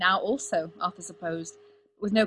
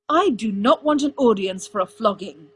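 loud, firm snap; irritated, forceful refusal; snapped; 2 semitones higher, 9 dB louder than usual. A narrator's reading. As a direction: snap it out, hard and emphatic. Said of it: "Arthur snapped".

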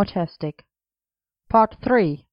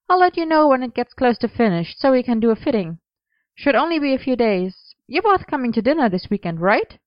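higher-pitched, chatty friend's voice; light, higher character voice; brisk friendly Gwen; 6 semitones higher than usual. A narrator's reading. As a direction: chat brisk and high while wrapping up. Gwen's dialogue voiced by narrator.

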